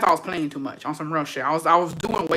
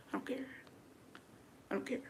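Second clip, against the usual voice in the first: very soft, higher-pitched retort; tiny dismissive voice, barely there; mock-indifferent reply, very quiet; hushed dismissal; 6 semitones higher, 24 dB quieter, faster than usual. Retold dismissive reply, nearly inaudible.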